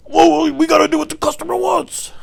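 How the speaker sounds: shrieky high mock boss; yelling boss. Boss insisting on pleasing the customer, imitated high and far from natural. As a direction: impersonate a boss yelling at staff. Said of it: "my boss was like".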